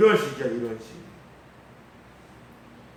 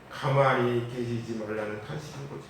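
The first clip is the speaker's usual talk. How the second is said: ordinary delivery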